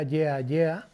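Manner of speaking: normal talking voice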